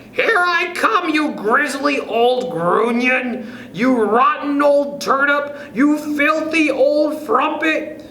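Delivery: high, slow, furious shriek from above; shrieking insults. A narrator's reading. Wife yelling insults at her husband from the sky, shrieking high. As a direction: hurl the insults down at him at the top of her voice. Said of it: "she suddenly called out from the top of her voice".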